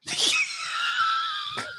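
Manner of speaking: high shrieking burst of laughter. Real, not acted. Hosts hear the funeral story and shriek with laughter.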